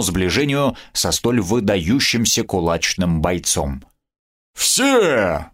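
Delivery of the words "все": bare your teeth and growl it at her; snarling growl. Acted. The goblin turns on the hostess, growling. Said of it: "с рыком ощерился гоблин".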